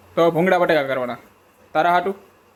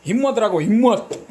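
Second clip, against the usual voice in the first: higher and louder, playful encouragement with a laugh; mock pep talk; 6 semitones higher, 4 dB louder than usual. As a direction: cheer them on like a hammed-up coach. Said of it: "સ્ફૂર્તિલા આમ ઘોડાની જેમ રહેવાનું".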